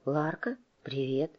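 drowsy and guarded, quiet; just woken, wary murmur; sleepy. Acted. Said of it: "Голос подруги был немного странным, насторожённым или, может быть, сонным".

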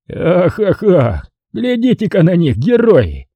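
high-pitched mock admiration; mock them with exaggerated awe; sneering mockery. A narrator's reading. Colonel to offended neighbours jumping up, jeering. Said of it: "с усмешкой проговорил Жора".

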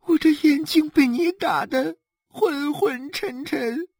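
halting, tearful whine; frightened sobbing. Acted. Slapped wife dares not tell her husband, sobbing. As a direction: whimper it out, catching breath. Beaten wife afraid to speak, crying.

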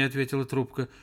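plain reading voice

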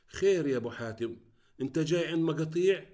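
high, loud indignant surprise; astonished. A narrator's reading. Hostess objects to a guest refusing food, astonished. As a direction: voice jumps up in astonished protest. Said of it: "باستغراب قائلة".

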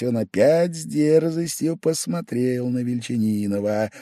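usual speaking voice

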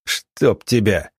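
slow, understated curse; mildly exasperated oath; cursing. A narrator's reading. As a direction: swear under pressure, drawing out each word. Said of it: "выругался Штольц".

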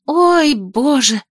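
high, slow mock gasp; theatrical gasp. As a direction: overplay shock, drawn out. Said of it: "выдохнула я, картинно прижав руку".

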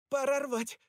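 urgent high call; decisive. Acted. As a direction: snap out the decision. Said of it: "решила она".

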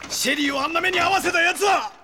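anguished angry yell; furious shout. Acted. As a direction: roar out the question in rage. Brother demands the killer's name.

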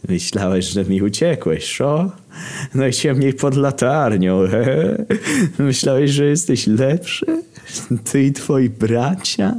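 villain's taunt with rising questions; Warol Kojtyła. Acted. Pope villain's taunting monologue.

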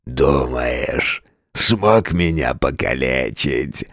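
mocking beast, low and slow; monster voice. A narrator's reading. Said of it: "спросил он".

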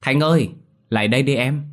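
high, loud call across the garden. A narrator's reading. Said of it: "Tôi gọi nhỏ em".

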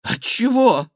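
very high, loud yelp; flustered, squeaking king; startled question. A narrator's reading. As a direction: ask as voice leaps in alarm.

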